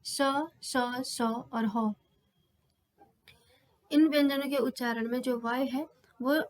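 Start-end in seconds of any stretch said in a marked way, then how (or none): 0.0-1.9 स, स, स और ह बहुत धीरे, साँस की रगड़ के साथ; हवा को रगड़ते हुए वर्ण खींचें; ऊष्म वर्ण. Shown as a demonstration. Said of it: "रगड़ करते हुए निकलती है". (छात्रों को ऊष्म ध्वनि सुनाते हुए धीमे वर्ण)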